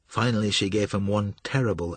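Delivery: ordinary delivery, nothing marked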